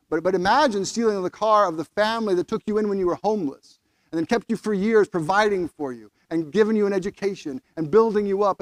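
ordinary talk, nothing marked

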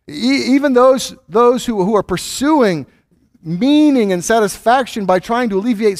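high, loud, impassioned preaching; preaching at full force. Acted. Pastor warning the congregation their pursuits are worthless, impassioned. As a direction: raise the voice and drive the warning home.

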